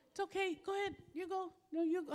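mock the endlessly polite drivers. Mimicking drivers waving each other on, high and polite.

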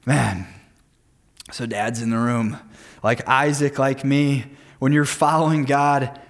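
usual speaking voice

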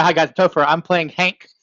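ordinary talk, nothing marked

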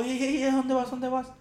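high, sharp mock yell of alarm; calling out to stop her; retold yell. Man shouting at woman who climbs into his car.

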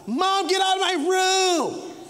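mock a sulky preteen; hammed-up teenager shouting at mom; bratty kid yell. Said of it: "he or she yells this out".

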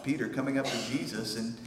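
normal talking voice

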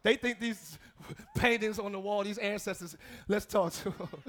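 chuckling through a sly spirit voice; mock the spirits while laughing. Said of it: "it's spirits".

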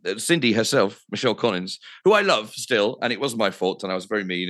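ordinary talk

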